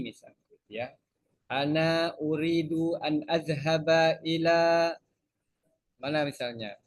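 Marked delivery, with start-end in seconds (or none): 1.5-4.9 slow, careful textbook Arabic greeting; formal Arabic greeting. Shown as a demonstration. Teacher shows students how a taxi greeting sounds. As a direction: spell out the polite fusha words slowly. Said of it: "nanti kita bilang".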